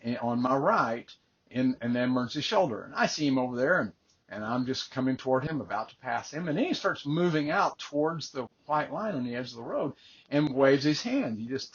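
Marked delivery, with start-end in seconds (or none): none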